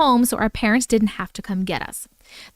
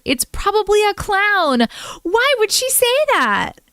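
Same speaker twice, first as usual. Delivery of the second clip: high, slow, drawn-out alarm; shocked disbelief; 9 semitones higher than usual. Acted. Reader reacting to mom's clown warning, shocked disbelief. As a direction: wail it high in mock horror.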